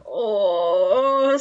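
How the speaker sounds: long, wavering confused "oh"; mock confusion. Imitating poor old Mister Granger baffled by the phone call, confused. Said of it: "so confused".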